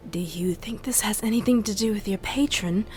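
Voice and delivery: ordinary talk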